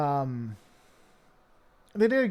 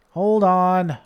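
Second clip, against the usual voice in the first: louder call to hold on; 4 semitones higher, 10 dB louder than usual. Real, not acted. Streamer halting chat over a broken stream, loudly. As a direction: stop everyone with a sharp call. Stream glitch, calling for a pause.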